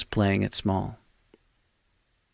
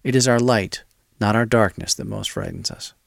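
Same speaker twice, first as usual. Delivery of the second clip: ordinary talk, nothing marked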